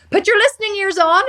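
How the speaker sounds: high, loud scolding command; Judge Judy. Retold or imitated. Quoting the TV judge her dad watches, loud scolding imitation. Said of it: "Judge Judy".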